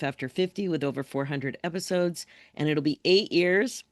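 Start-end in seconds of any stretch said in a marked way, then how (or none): none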